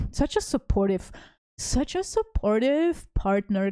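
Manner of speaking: drawn-out mock praise; slow, heavily ironic compliment; sarcastic. Real, not acted. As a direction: lay on fake admiration, stretching the words.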